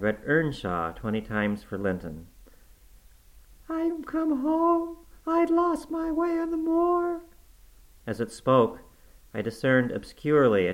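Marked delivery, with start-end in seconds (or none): 3.7-7.2 put-on very high, slow, quivering child's voice; shivering ghost. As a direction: answer as a freezing lost girl. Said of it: "it replied shiveringly".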